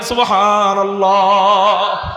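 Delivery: a long drawn-out loud cry of সুবহানাল্লাহ; shouted praise. Acted. Preacher leads the crowd in a shout, drawn-out and loud. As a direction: call it out for the crowd to echo. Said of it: "চিৎকার দিয়ে বলেন".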